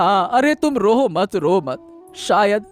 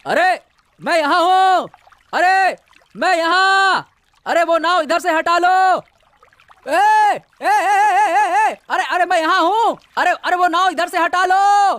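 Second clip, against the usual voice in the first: calling out; 6 semitones higher than usual. Acted. Fisherman shouting at the big boat to stop, frantic. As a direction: yell across the water so they see you.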